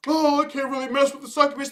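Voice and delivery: mocking whine. Mocking his hesitation.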